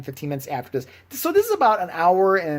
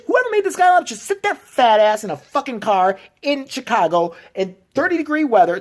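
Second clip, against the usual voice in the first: angry rant; 6 semitones higher, 3 dB louder than usual. Real, not acted. Continued rant.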